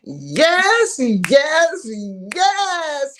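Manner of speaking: excited yes. Real, not acted. Host's signature opening call.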